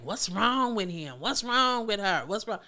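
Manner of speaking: judgmental people. Retold or imitated. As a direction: scold like a frustrated onlooker. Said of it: "A lot of people be like".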